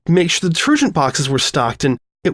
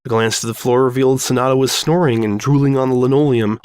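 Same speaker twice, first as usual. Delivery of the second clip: usual speaking voice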